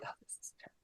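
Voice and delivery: barely audible, imitating upset audience members; mock scandalized whisper. Upset audience members to each other, mock whisper. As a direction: whisper it like an offended couple at a show.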